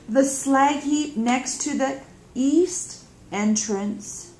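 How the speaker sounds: slow, patient explaining. Acted. Younger sister patiently explaining the directions to her sister. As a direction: spell it out gently, slowly. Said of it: "says Prim patiently".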